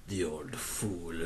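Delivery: low, slow narrator voice; movie narrator voice. Acted. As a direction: gravely intone it like a trailer. Narrator-style line, low slow.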